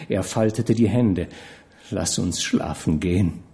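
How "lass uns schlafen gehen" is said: tearful plea. A narrator's reading. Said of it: "Myfas Augen füllten sich mit Tränen".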